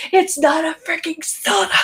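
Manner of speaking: high-pitched mock outrage; butthurt commenter. Imitating a viewer complaining about a name, mock outraged. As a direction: whine it high like an offended commenter. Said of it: "someone got so butthurt".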